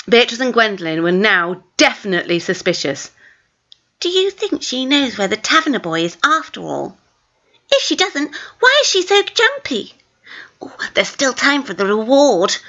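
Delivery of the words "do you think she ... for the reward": put-on scheming girl. Twins scheming.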